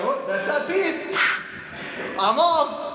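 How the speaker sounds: breezy exit. Retold or imitated. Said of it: "you're like".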